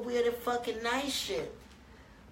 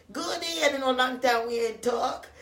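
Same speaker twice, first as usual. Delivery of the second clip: imitating the girl; 5 semitones higher, 5 dB louder than usual. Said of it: "the girl called to her, like".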